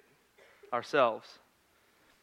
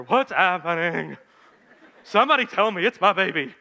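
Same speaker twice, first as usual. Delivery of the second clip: loud, desperate cry from the table; a frightened mother begging for answers; panicked plea; 4 semitones higher, 7 dB louder than usual. Retold or imitated. Wife on the delivery table, crying out in panic.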